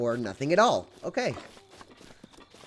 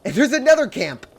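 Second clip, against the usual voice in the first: excited; 6 semitones higher, 8 dB louder than usual. Real, not acted. Spots another enemy camp.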